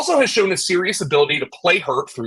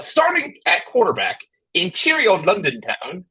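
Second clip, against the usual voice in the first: hammed-up fake British accent, pitched up high on the first words; terrible British accent; 4 semitones higher than usual. Host opening the quarterback slot, in a terrible mock British accent. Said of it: "that was a terrible British accent".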